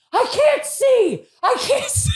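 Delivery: high, loud, slow scream breaking into laughter; laughing mock scream. Retelling the tortilla on her face, laughing scream. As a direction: scream it blind but crack up. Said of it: "I was like this".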